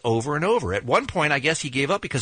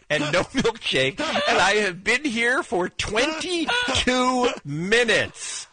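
exasperated exclaim; 7 semitones higher, much slower than usual. Retold or imitated. Host exasperated telling the story.

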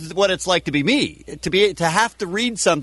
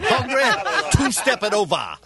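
mock Texan ad voice; 3 semitones higher than usual. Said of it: "that's terrible".

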